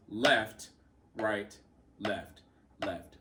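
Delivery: spaced-out strokes, each after the first "left" dropping away; accented first stroke. Shown as a demonstration. Drum teacher shows accent versus taps, first "left" stressed. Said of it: "Notice the volume of the accent".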